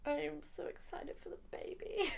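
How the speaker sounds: teary whisper through laughter; half laughing, half crying, barely voiced; choked-up happy whisper. Real, not acted. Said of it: "power through the crying".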